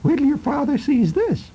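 high, lightly voiced mother; mother's voice. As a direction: squeak it out like a scolding mom.

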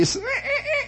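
thin, high squeaky noises; imitated squeaking. Storyteller imitating Laurel and Hardy, squeaking. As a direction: squeak like a frightened comic. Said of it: "they're squeaking".

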